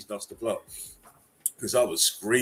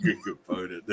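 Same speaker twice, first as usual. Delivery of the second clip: ordinary talk, nothing marked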